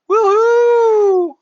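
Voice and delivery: long high loud whoop; excited cheer. Real, not acted. Streamer cheering to chat, loud high whoop. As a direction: let out a big celebratory woohoo.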